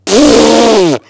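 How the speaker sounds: tearful. A narrator's reading. Martha still sobbing, after nose blowing.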